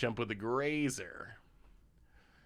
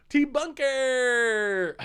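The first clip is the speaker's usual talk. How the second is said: excited shout; 12 semitones higher, 6 dB louder than usual. Real, not acted. Shouts card name.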